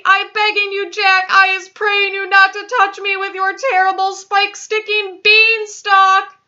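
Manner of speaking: high, loud wailing plea; screamed begging. A narrator's reading. Giant fleeing Jack's beanstalk in a dream, screamed begging. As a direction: scream and wail for mercy, stretching key words. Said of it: "screamed the flesh lump eater".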